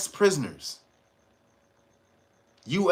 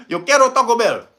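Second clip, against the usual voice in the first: louder put-on chihuahua mascot voice; hammed-up ad mascot imitation; mock Taco Bell chihuahua; 4 semitones higher, 5 dB louder than usual. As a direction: ham up the Taco Bell dog's catchphrase. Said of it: "They gonna say".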